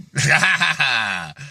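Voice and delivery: drawn-out cackling burst of laughter; long laugh. Real, not acted. Laughing at his own rant, long laugh. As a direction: let a long laugh roll out.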